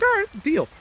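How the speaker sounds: high, squeaky mock agreement; mock high Barry. Barry accepting the council deal, in a high mock voice.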